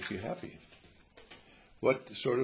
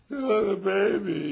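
mock noble; 9 semitones higher, 10 dB louder, slower than usual. Pretend noble choice for baby.

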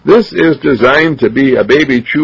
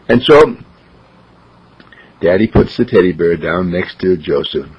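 usual speaking voice

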